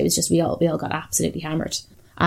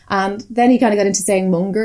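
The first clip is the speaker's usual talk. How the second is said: usual speaking voice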